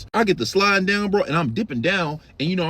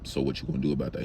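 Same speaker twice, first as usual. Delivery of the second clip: low, flat demand; 13 semitones lower, 8 dB quieter, faster than usual. Retold or imitated. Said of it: "reply to cold food".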